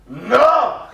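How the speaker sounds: high, loud Scottish yell back; Scottish shouted retort. Retold or imitated. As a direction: fire back at the driver behind. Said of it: "the guy in front goes".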